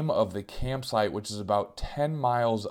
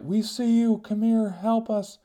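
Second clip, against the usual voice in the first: high, urgent calls from the dark; pleading family; 11 semitones higher, 3 dB louder than usual. Retold or imitated. Stranded family calling to the hiker, high and pleading. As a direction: beg the stranger to come over. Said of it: "they're like".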